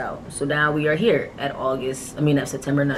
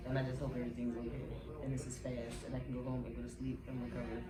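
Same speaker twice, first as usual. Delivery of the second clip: hushed; 17 dB quieter than usual. Real, not acted. Nervous before surgery, hushed.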